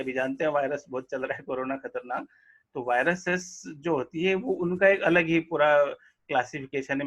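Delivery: normal talking voice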